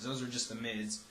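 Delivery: normal talking voice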